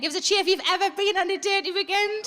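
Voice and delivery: ordinary talk, nothing marked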